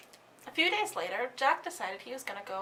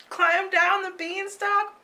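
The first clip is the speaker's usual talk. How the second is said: high, loud, urgent narration; frantic; 8 semitones higher, 9 dB louder, slower than usual. A narrator's reading. Narrator tells of Jack fleeing the giant, high and urgent. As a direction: rush the words like a chase.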